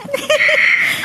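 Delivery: short bright burst of laughter; laughing. Real, not acted. Friend laughs at the Bagyo girl joke, laughing. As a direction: laugh at your friend's teasing.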